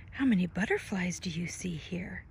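ordinary delivery

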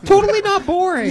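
high-pitched, loud sarcastic gushing; over-the-top squeal of fake interest; mock enthusiasm. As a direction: tease with exaggerated delight. Mock enthusiasm at boring job.

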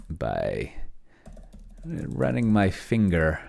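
slow, deliberate dictation of a prompt; measured dictating voice. Shown as a demonstration. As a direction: spell it out slowly for the machine to take down. Dictating an action to the AI.

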